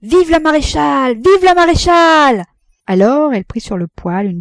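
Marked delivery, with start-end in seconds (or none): 0.0-2.4 loud, high cheering cry; cheering crowd. A narrator's reading. Les convives acclament Rosanette en criant. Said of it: "tous applaudirent en criant".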